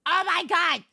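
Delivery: high exclamation of shock; exclaimed disbelief. Real, not acted. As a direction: cry it out, pitch jumping up. Repeats it as a louder exclamation.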